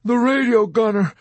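loud, high, desperate plea; begging. A narrator's reading. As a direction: cry out, pleading for his crewmate. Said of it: "Dobbs begged".

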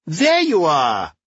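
put-on loud, slow Brooklyn-accented hail; roaring greeting. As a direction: call out in a thick Brooklyn accent. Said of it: "she roared".

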